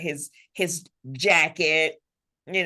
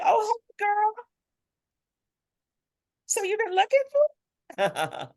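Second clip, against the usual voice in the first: personified love. Acted. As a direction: coo it high, like love teasing a girl.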